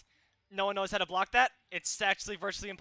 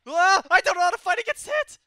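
high, loud exasperated cry; frustrated outburst; 12 semitones higher, 14 dB louder than usual. Real, not acted. Gamer losing to a Hit player, loud frustrated cry. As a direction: vent it loudly.

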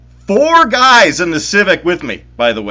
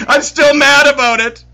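high, louder laughing protest; 11 semitones higher, 4 dB louder than usual. Real, not acted. Storyteller laughing while insisting he's still mad. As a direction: laugh through the claim of anger. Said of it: "Take a breath".

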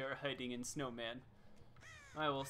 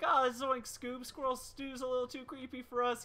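Shaggy voice; 8 semitones higher than usual. Retold or imitated. Quoting the fleeing Scooby-Doo gang, in a lightly voiced Shaggy. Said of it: "they're like".

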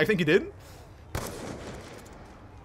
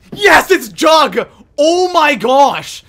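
loud, high, drawn-out cheer; elated shout; 5 semitones higher, 10 dB louder, much slower than usual. Real, not acted. Gamer gets the Jug he hoped for, elated shouting. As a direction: shout it out in real jubilation. Celebrates getting the hoped-for perk.

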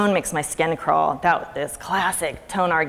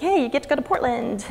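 cheery mom; 8 semitones higher than usual. Retold or imitated. Mom cheerfully telling daughter.

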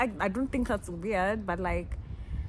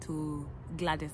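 put-on lower, softer shy boy; timid boy; 5 semitones lower, 6 dB quieter than usual. Steve at the gate asking for Gladys, in a lower, softer shy voice. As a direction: mumble it like a nervous kid. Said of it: "Then Steve was like".

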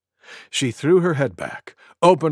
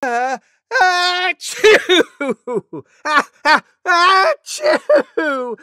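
very high, loud double sneeze; disgusting sneeze; 5 dB louder, much slower than usual. Acted. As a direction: squeal out huge exaggerated sneezes. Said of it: "disgusting sneeze like this".

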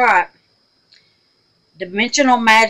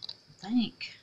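whisper; 3 semitones higher, 11 dB quieter than usual. Real, not acted. Hushed unsure aside.

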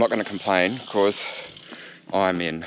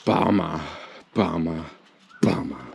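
each bummer lower and softer, hammed-up sinking; mock dramatic disappointment; 6 semitones lower, 4 dB quieter than usual. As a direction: sink lower on every bummer for show. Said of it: "Just for dramatic effect".

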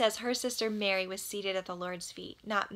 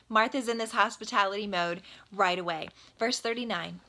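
normal talking voice

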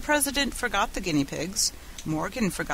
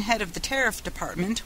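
usual speaking voice

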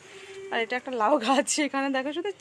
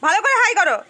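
loud coaxing; 8 semitones higher, 11 dB louder than usual. Real, not acted. Coaxing son to wave hi.

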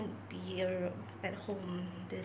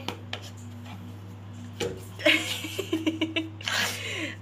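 high, loud burst of laughter; 9 semitones higher, 5 dB louder than usual. Real, not acted. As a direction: let a big laugh out. Laughing at dog.

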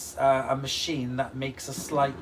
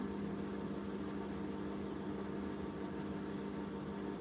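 long silent laugh; 6 semitones lower, 15 dB quieter than usual. Real, not acted. Laughing at a chat joke.

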